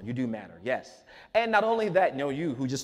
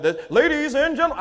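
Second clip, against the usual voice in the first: higher, louder pompous announcer flourish; hammed-up orator voice; 10 semitones higher, 7 dB louder than usual. Shown as a demonstration. Speaker parodies his old grand keynote style. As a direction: ham up a grand keynote opener. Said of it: "That's not my natural voice".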